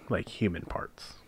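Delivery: hushed, slow reveal of something grisly; dramatic whisper. Real, not acted. Recapping the episode's grisly reveal to the hosts, slow hushed whisper. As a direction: drop to a whisper for the gruesome twist.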